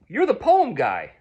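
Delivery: loud, high retold exclamation; excited recognition. Man recognizing radio poet at church, excited. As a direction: call it out as you recognize him.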